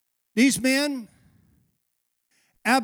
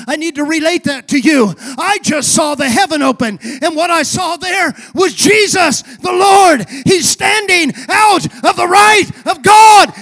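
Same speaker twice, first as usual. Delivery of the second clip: louder and louder, higher proclamation of the vision; proclaiming shout; 4 semitones higher, 9 dB louder than usual. Acted. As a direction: shout it like you see heaven open. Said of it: "he looks at them and says".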